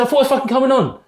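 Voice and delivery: high-pitched, heated re-enactment; retold angry protest. Benched young player challenging man in tunnel, angry protest. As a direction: protest the snub, pitch right up.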